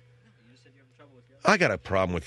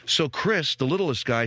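usual speaking voice

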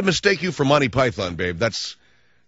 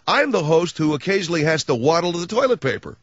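high cartoonish mock voice; Comb Over Boy voice; 6 semitones higher than usual. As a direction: ask it in the silly high voice. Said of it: "you should say".

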